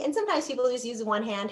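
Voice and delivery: ordinary delivery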